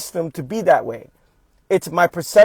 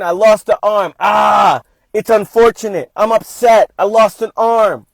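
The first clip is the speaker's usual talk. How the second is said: loud, higher and emphatic, admitting the pain; upset but accepting; 5 semitones higher, 9 dB louder than usual. Acted. Speaker acting out losing an arm with honest feeling, loud and emphatic. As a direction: declare the loss out loud, then accept it. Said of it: "we're not talking about that".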